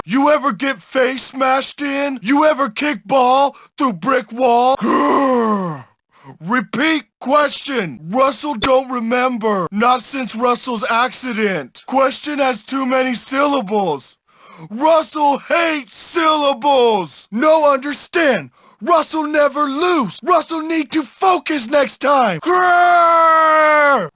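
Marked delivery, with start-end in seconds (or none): 4.8-5.9 long growl trailing downward; brutish growl. Acted. Bully growls between lines. 22.4-24.1 long, loud brutish "grrr"; frustrated growl. Acted.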